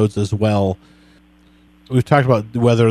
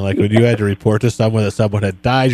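usual speaking voice

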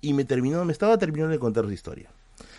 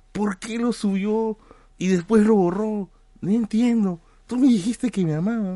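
higher, louder pleading voice; confused lovesick driver; 9 semitones higher, 5 dB louder than usual. Retold or imitated. Taxi driver asking Merlín why she deleted it, high and pleading. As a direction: plead like a baffled heartbroken guy.

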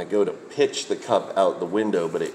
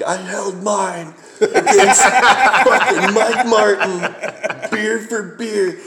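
put-on high, slow, laughing drunk brag; drunken boast; 13 semitones higher, 8 dB louder, slower than usual. Bragging to his bandmates about matching Mike beer for beer, high laughing drunk boast. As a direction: crow it out like a proud drunk through laughter. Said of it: "And I'm all like".

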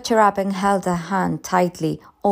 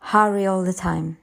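plain reading voice

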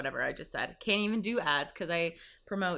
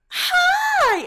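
shrieked high greeting, much louder; over-the-top chirpy neighbor; 13 dB louder than usual. Retold or imitated. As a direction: squeal a bubbly hello up high and loud. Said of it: "she's like".

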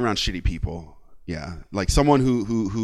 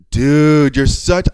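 shocked; 3 semitones higher, 7 dB louder, much slower than usual. Retold or imitated. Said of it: "I was so shocked that I just went".

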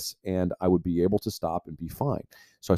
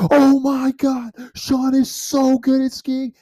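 much higher, slow, gushing buddy yell; pumped buddy; 4 dB louder, slower than usual. Retold or imitated. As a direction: imitate the hyped friend squealing.

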